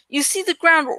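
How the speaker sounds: plain reading voice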